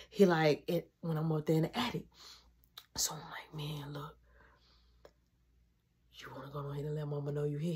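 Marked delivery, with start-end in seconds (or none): 3.5-7.7 quick, hushed whisper; fast whisper. Retold or imitated. Urging brother to tell mom, fast whisper.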